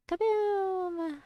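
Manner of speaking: high, drawn-out explosion call; playful kaboom. Real, not acted. Player blowing things up, playful high kaboom. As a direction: stretch the boom out, sliding gently down.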